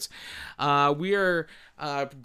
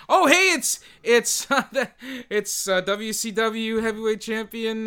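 excited; 9 semitones higher, 8 dB louder than usual. Acted. Spotting a celebrity at awards.

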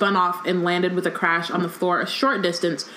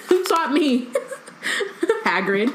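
high, slow question through laughter; laughing; 6 semitones higher than usual. Real, not acted. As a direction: crack up while asking it. Laughing while mocking the boast.

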